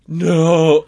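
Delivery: high, loud slow-motion "no"; mock horrified cry. Onlookers watching her head head for concrete, mock horror.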